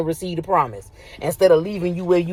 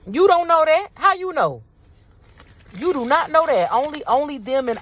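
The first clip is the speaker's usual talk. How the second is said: incredulous challenge; 9 semitones higher than usual. Real, not acted. Challenging listeners' presumptions.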